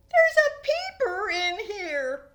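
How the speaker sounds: high, loud amazed cry; excited discovery. Acted. Boy finding a frog in his room, amazed and excited. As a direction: blurt it out in wonder.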